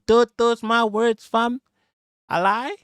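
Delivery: high, slow put-on Canadian voice; mock Canadian voice. Mocking how Canadians talk to the streamer, in a high put-on Canadian voice. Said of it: "Canadians talk like this".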